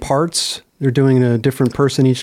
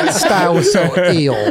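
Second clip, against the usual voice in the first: laughing mock quote. Shown as a demonstration. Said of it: "That boy has an eel style".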